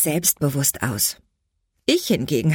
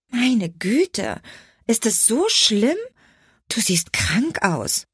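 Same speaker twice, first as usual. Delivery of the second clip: concerned friend; 6 semitones higher, slower than usual. Acted. Merle voiced in dialogue.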